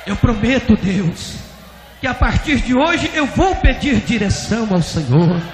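loud, high impassioned promise; full-force preacher acting repentance; fervent prayer vow. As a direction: cry the vow out to God. Pastor's vows to God continue.

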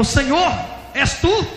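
startled pastor asking God. Retold or imitated. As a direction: call up to the Lord, pitch leaping. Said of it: "Ele falou".

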